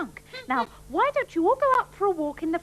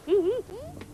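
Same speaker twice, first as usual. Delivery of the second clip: gloating laugh; 3 semitones higher than usual. Acted. Sue gloats over stolen sweets.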